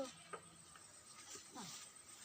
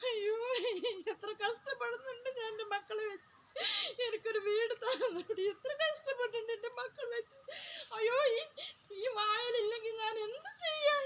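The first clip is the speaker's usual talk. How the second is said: tearful, sobbing lament; crying lament. Acted. Homeless mother lamenting to the viewer, crying through the words. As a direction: cry through the words, voice breaking.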